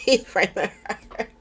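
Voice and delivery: laughing it out as she repeats it; chuckling through the words. Real, not acted. Recapping the soap to viewers, laughing.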